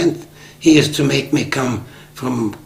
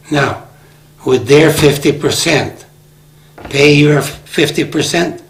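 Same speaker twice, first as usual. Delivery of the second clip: louder retold challenge, slowing at the end; retold angry demand, louder and deliberate; ticked off; 3 semitones higher, 7 dB louder than usual. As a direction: press him hard on each figure. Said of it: "I got so ticked off".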